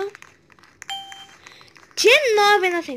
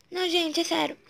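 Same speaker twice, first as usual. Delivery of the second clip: ordinary talk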